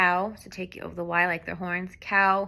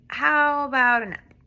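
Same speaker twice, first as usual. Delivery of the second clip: playful offer; 7 semitones higher, 8 dB louder than usual. Acted. Playful offer in sign story.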